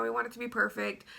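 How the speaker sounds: ordinary delivery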